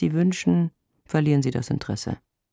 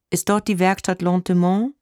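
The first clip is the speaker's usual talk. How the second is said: higher-pitched inquiring caller; higher, querying voice of a caller; phone caller; 7 semitones higher, 4 dB louder than usual. A narrator's reading. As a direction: ask as a stranger on the line. Caller asks, wrong number.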